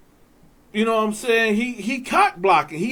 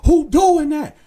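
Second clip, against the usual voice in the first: raised, high, insistent officer's yell; imitating angry guard; 4 semitones higher, 3 dB louder than usual. Guard yelling at inmates to stop singing, acted shout. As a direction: bark orders like an exasperated guard.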